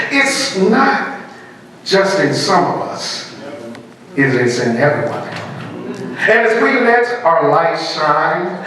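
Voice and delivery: measured oratory with deliberate pauses; inspirational recitation. Acted. Speaker opening a monologue competition with a quoted passage, inspirational recitation. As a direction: recite it like a stirring keynote, letting phrases land.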